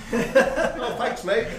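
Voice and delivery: laughing mock relief. Manager thanking the bloke who found the gate, laughing. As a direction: gush a laughing thank-you.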